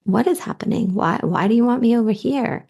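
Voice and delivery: high, curious "oh", lifted questions; acted excitement. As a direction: play pleasantly intrigued excitement. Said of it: "It's like excitement".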